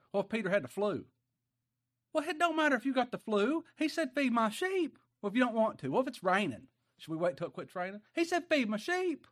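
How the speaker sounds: high, loud revival-preacher climax on Feed my sheep; preaching at full force. Acted. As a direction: hammer the answer home every time.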